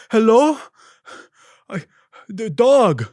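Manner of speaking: high, loud, strained voice of a lost driver; a panicked, high-pitched delivery guy; confused delivery driver. Retold or imitated. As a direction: play the flustered driver on the phone. Driver answering phone, confused.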